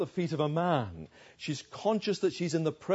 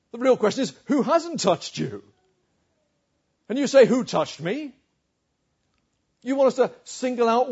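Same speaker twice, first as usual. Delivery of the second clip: incredulous, higher and louder protest; exasperated disciples; 5 semitones higher, 5 dB louder than usual. Acted. Preacher voicing the disciples' incredulous protest. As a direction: disciples scoffing at their teacher. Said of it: "and his disciples said to him".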